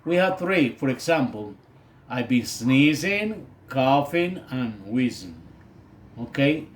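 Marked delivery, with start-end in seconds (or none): 2.1-5.4 sneezing and coughing rising, wheezing falling; list intonation demo. Shown as a demonstration. Teacher shows students three symptoms, rising then falling. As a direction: lift each item, drop the last. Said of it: "In the first two, there's rising intonation".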